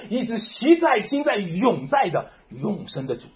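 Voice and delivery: rally the congregation with every question; fervent preaching. Acted. Pastor pressing believers about the Son, preaching at full force.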